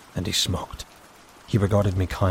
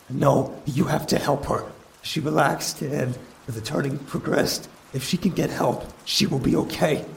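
much higher, pleading, breaking toward a whisper at the end; a desperate brother pleading before the council; desperate plea; 8 semitones higher than usual. Acted. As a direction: beg them to save her.

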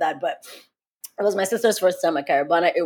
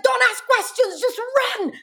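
very high, urgent cry; reenacted panicked order. Urging sister to flee gunshots, high panicked reenactment. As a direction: yelp the order as if fleeing.

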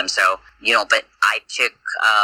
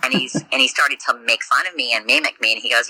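ordinary talk

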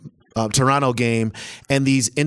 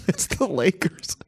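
whispered with a laugh, higher; 6 semitones higher, 4 dB quieter, slower than usual. Real, not acted. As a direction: breathe it out in a giggly whisper. Whispered repeat of answer.

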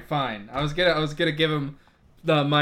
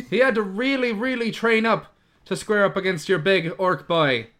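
raised-voice hype man; fast-talking promoter; 4 semitones higher than usual. Acted. Promoter walking up to defend his fighter, raised insistent pitch. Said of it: "I walk up and I'm like".

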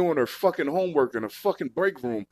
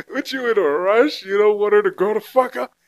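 mocking challenge; 3 semitones higher, 6 dB louder than usual. Real, not acted. Mocking the creep, louder.

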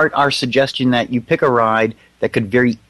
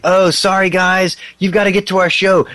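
higher, lightly acted rocker voice; rock band in pre-show; 8 semitones higher than usual. Band in a recording session excusing themselves to riders, lightly voiced. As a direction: play a hint of the apologetic band member. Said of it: "see the recording session".

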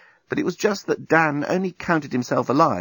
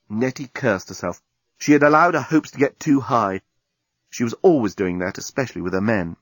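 ordinary delivery, nothing marked

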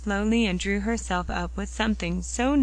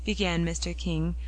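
plain reading voice

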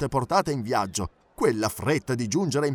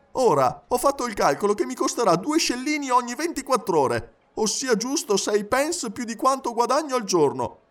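high, agitated valet's voice; animated high-pitched lament; worried Passepartout; 10 semitones higher than usual. Acted. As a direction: fret volubly in a raised pitch. Passepartout frets about gas burner.

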